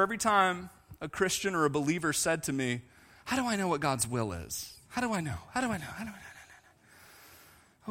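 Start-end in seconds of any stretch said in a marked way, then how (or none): 3.2-7.9 soft repeated question fading to a whisper; imitated anxious questioning. Believers asking the preacher about God's will, fading away. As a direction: mimic them, trailing away into breath.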